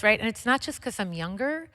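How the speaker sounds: usual speaking voice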